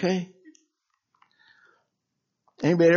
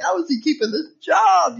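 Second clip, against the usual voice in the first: high, baffled question; incredulous; 10 semitones higher than usual. Retold or imitated. Wondering aloud how the lazy coworker keeps his job, high and baffled. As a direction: ask it in mock disbelief. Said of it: "I'm like".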